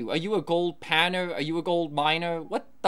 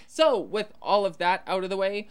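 ordinary talk

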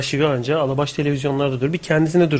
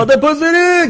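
shouting; 13 semitones higher, 5 dB louder, slower than usual. Pretend. Yelling the town name.

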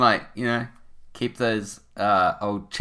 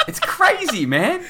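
laughing; 3 dB louder, slower than usual. Real, not acted. Laughing at the video.

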